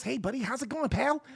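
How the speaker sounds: a hint of a friendly suburban guy. Host shows how you could talk, mild mock friendly. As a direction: lightly put on cheerful buddy voice. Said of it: "you can talk like this".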